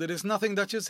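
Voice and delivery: ordinary delivery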